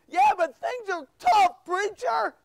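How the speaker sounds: high, loud whine at the preacher; whiny churchgoer protesting; whining. Acted. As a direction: complain in a put-on, high-pitched moan. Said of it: "Quit whining".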